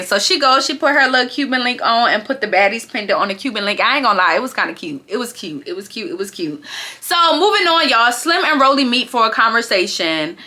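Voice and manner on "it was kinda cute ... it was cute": fading to a murmur; fading softer. Real, not acted. Vlogger conceding the chain was cute, fading murmur. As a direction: say it softer each time till it nearly disappears.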